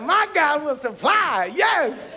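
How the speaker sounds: high, loud, slow laughing shout; joyful shouted faith; triumphant praise. Retold or imitated. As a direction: declare it like a victory. Triumph after refund.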